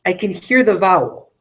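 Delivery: usual speaking voice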